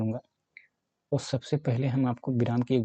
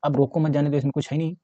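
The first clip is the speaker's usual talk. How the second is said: ordinary talk, nothing marked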